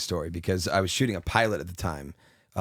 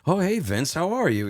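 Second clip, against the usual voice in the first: raised, excited greeting a notch higher; Harrison Ford voice; 9 semitones higher than usual. Retold or imitated. Harrison Ford picking up the phone, pleased and higher. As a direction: play Harrison Ford, thrilled to hear from Vince. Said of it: "And he's like".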